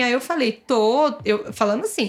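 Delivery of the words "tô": ordinary talk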